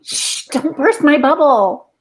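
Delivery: usual speaking voice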